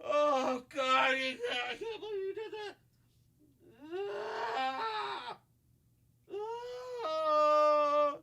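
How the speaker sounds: high, thin, strangled wail; hammed-up sobbing, squeezed high and soft; acted agonized crying. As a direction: whimper and moan through mock agony. Acts kicked man wailing in agony.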